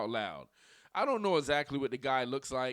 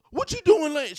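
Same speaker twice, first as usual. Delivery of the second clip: put-on high, loud outburst as an imagined girlfriend; loud mock confrontation; 12 semitones higher, 10 dB louder than usual. Acts out woman banging about angrily.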